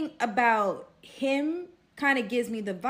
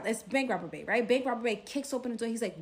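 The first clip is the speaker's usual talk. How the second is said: normal talking voice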